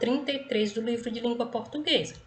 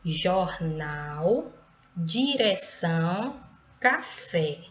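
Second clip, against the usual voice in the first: "jornal, direção, café", each drawn out onto its final syllable; teacher's separated word list, endings stressed in "jornal, direção, café"; stress on last syllables; much slower than usual. Shown as a demonstration. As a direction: sound out "jornal, direção, café" slowly, leaning on NAL, ÇÃO, FÉ. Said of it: "a última sílaba é pronunciada com mais intensidade".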